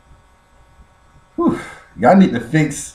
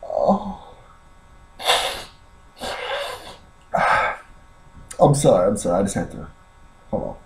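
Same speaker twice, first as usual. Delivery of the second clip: off mic; 5 semitones lower, 10 dB quieter, faster than usual. Real, not acted. Stepped away, still laughing.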